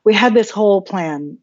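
usual speaking voice

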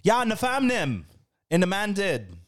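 high-pitched Toronto slang impression; Toronto accent. Host demonstrating the Toronto accent to puzzled friends, Toronto accent. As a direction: lay on a thick Toronto accent. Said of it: "The Toronto accent".

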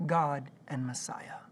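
slow, measured prayer for repeating. Shown as a demonstration. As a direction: pray it slowly, pausing for echo. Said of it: "I'm gonna say it slowly".

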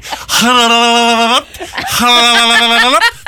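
high, loud trilling phone ring; rotary phone ring impression. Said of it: "uncanny impression of a rotary phone".

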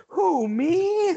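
mock-innocent, high-pitched question; John Candy. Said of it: "this stupid look on him".

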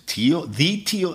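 can't believe who's calling; surprised disbelief. Retold or imitated. Drummer stunned that the famous producer called. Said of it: "And I said".

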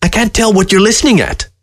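high-pitched, exasperated grumble; peevish creature complaint; complaining fox. A narrator's reading. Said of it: "complained Fox".

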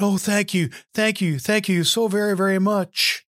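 high, gushing thanks; rescued hiker thanking God, high and gushing; grateful relief. Acted. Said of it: "And the man said".

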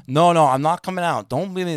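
loud, high, exasperated outburst; fed-up refusal. Retold or imitated. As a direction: imitate an angry boss refusing to budge.

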